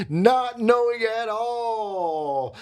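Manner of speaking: high, drawn-out words with a laugh; amused emphasis. Real, not acted. Speaker on the girlfriend's ignorance, laughing and drawn out. Laughing at his own hidden insecurity.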